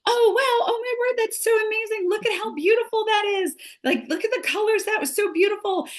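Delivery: high, bright gasping awe at the fish; mock wonder. Acting out wonder at aquarium fish.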